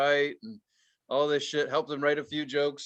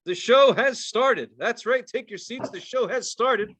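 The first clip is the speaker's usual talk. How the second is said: booming, high announcer voice; game show host; 7 semitones higher than usual. Acted. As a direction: hype the crowd like a host. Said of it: "you were doing the game show voice".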